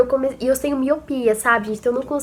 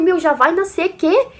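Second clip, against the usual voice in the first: surprised disbelief; 6 semitones higher, 4 dB louder, slower than usual. Retold or imitated. Told it's time for the birth.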